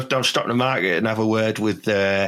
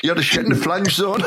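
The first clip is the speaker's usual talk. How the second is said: laughing; 9 semitones higher than usual. Real, not acted. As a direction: barely get it out for laughing. Cracking up at own story.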